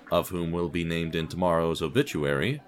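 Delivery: usual speaking voice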